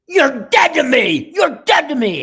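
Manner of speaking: high, drawn-out furious shout; furious threat. Retold or imitated. Enraged boss disowning the cameraman, shouted high and loud. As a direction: yell it slowly, disowning someone at full force. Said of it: "those eyes that are almost fiercer than Pharaoh's".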